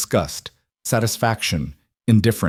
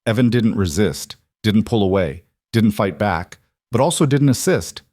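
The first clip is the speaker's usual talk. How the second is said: plain reading voice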